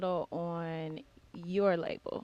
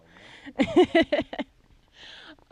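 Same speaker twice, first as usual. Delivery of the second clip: loud burst of laughter; 9 semitones higher, 12 dB louder than usual. Real, not acted. Host cracks up at voice.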